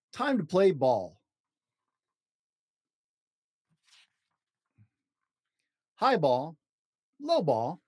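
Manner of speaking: slow, lilting picture-book read with long page-turn pauses; reading to children. Acted. Dad reading a picture book to his child, slow storybook lilt. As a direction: read it like a bedtime storybook for a little one.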